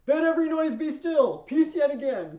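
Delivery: very loud, high command for silence; full-voiced order to be still; shouting everybody down. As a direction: bellow over a noisy crowd. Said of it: "Casca's gotta shut everybody up".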